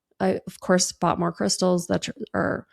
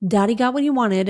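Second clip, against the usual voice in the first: ordinary delivery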